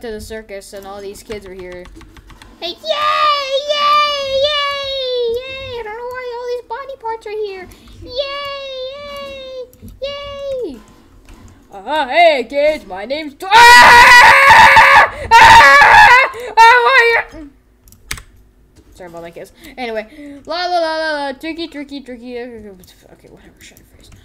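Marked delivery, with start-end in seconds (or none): 2.6-10.8 very high, very slow squeaky clown cheer. Acted. Clown cheering at the circus kids in a squeaky voice. 11.7-13.4 loud, a little high clown greeting. Acted. Clown loudly greeting the circus kids. 13.4-17.5 very loud, very high screaming. Acted. Clown screaming after being blown up. 22.4-24.1 whispered aside. Real, not acted. Muttered dismissal.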